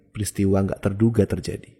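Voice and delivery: plain reading voice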